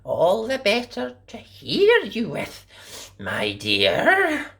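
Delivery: high, sly fake granny; wolf as grandma. A narrator's reading. Disguised wolf answering the girl, sly granny voice. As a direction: answer sweetly with a hidden bite. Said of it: "said the wolf".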